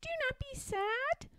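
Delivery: very high, soft bird voice; comforting little robin. A narrator's reading. Robin comforting Mother Bear, small and soft. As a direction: soothe in a tiny, high squeak.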